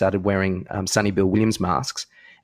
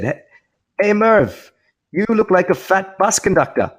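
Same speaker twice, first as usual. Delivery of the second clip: raised-voice heckle with pauses; Javed Miandad; 9 semitones higher than usual. Acted. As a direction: call out a slow, pointed sledge. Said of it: "Javed Miandad, uh, said".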